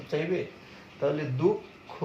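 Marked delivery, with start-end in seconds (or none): 1.4-1.9 দুঃখ as "duk... kho", with a held gap; two clear pieces in দুঃখ; doubled consonant. Shown as a demonstration. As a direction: stop on the "k" of দুঃখ, then release "kho". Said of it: "এরকম কিন্তু".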